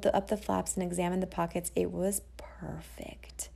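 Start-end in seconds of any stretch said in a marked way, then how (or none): 1.7-3.5 whisper. A narrator's reading. Longing over the bag.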